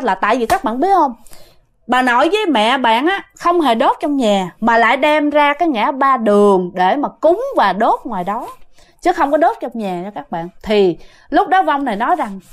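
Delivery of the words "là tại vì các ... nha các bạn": usual speaking voice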